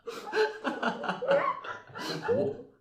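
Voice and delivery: high-pitched burst of laughing; real high laugh; laughter. Real, not acted. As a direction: crack up laughing. Players laugh at the scene.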